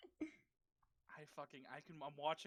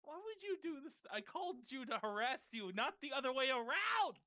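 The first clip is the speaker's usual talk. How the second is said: mock outrage; 9 semitones higher, 6 dB louder than usual. Mock outrage at being teased.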